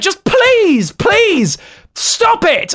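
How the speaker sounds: high, fed-up begging; exasperated pleading. Real, not acted. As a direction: beg them to stop, annoyed.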